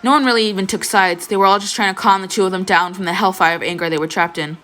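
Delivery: ordinary talk, nothing marked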